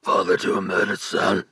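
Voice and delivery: put-on famous film line in a gravelly testosterone accent; gruff male-lead impression; gravelly actor voice. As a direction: put on a weighty, manly movie-hero delivery. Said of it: "the most famous example being".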